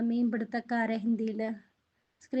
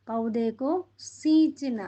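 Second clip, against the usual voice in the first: പൗതേക്കോ സീഞ്ചന slowly, leaning on സീഞ്ചന; slow teaching read; 3 dB louder, much slower than usual. Shown as a demonstration. Teacher to pupils, modelling the phrase slowly. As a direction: lay out പൗതേക്കോ സീഞ്ചന word by word. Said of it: "എന്താണ്?".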